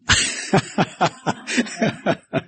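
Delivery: soft, breathy chuckling; a run of quiet, voiceless chuckles; amused laugh. Real, not acted. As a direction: laugh softly at your own slip. Amused by the silent W slip.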